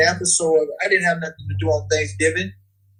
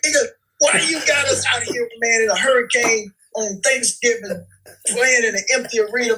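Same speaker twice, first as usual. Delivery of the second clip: higher mock-angry complaint, retold; retold mad rant; 9 semitones higher, 3 dB louder than usual. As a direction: replay the irritation, pitch raised high. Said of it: "I was so mad".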